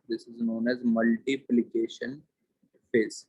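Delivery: very slow, word by word; slow dictating voice; dictation pace. Shown as a demonstration. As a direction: read it out slowly for notes. Dictating notes to class.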